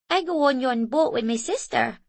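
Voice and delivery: proud. A narrator's reading. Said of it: "said Hari proudly".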